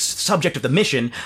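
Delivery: ordinary talk, nothing marked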